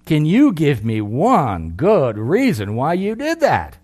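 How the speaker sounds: out of breath and fed up; exasperated. Retold or imitated. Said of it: "I gasped in exasperation".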